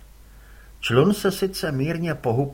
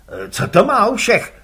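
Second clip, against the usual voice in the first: louder gruff curt reply; gruff father; 6 semitones higher, 10 dB louder than usual. Acted. Father answers his son curtly, gruff.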